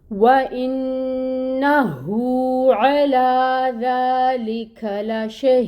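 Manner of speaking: slow, melodic tajweed reading of the whole verse; full verse recitation. Shown as a demonstration. Teacher reciting the complete verse for students, slow melodic tilawat. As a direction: chant the verse slowly, every rule applied. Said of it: "آیا مبارکہ کو پورا پڑھ کے دیکھ لیتے ہیں".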